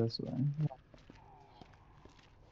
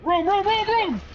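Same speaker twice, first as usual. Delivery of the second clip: frightened shrieks. Real, not acted. Seeing a monster dog, she shrieks in fright. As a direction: squeal in terror at the dog.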